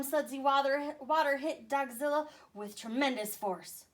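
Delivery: ordinary delivery, nothing marked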